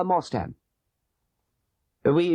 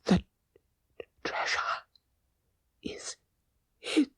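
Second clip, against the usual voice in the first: last breathless whisper, much softer; dying whisper; 8 semitones higher, 8 dB quieter than usual. A narrator's reading. Dying father whispering the treasure's secret to his sons. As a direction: barely breathe the words out as life fails. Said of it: "Put your ears to my mouth".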